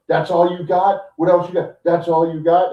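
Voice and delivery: loud, high mock confrontation; imitated yelling. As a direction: act out yelling in someone's face. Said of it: "Seth starts yelling at him".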